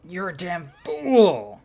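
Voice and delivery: slow, loud, high yell; over-the-top loud slow insult; max mode insult. Acted. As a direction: bellow the insult at full power. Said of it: "I should use max mode here".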